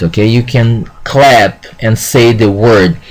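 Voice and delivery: normal talking voice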